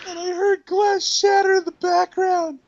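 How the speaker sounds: high, slow, laugh-choked reread; squeezing the words out between laughs; laughing while reading. Real, not acted. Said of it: "reread laughing".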